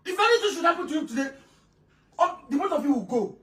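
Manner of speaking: usual speaking voice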